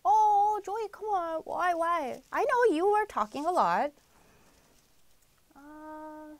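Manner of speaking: ordinary delivery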